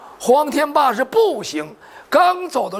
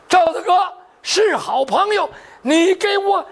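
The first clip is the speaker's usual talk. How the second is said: high, heated demand to step aside; threatening order; 6 semitones higher than usual. Acted. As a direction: build to a high, forceful command. Drawn sword, orders friend aside. (持刀厉声喝令闪开)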